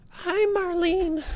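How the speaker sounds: very high, slow falsetto greeting; put-on high voice. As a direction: stretch it out in a squeaky high voice. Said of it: "I can't do a Marlene voice".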